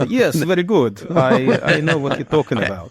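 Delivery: slow Russian-accented villain; evil Russian accent. Shown as a demonstration. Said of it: "I can't do a good Russian accent".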